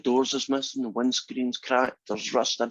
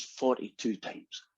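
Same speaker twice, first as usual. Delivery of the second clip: quiet confession; 8 dB quieter, slower than usual. Acted. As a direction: own up softly and slowly, acting it out. Reluctant confession of infidelities in joke.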